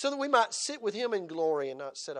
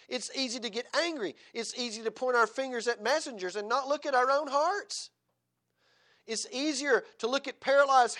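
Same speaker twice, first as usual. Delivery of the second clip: loud, high fiery preaching; preaching at full force; 9 semitones higher, 7 dB louder than usual. Acted. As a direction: thunder it from the pulpit. Fiery sermon passage.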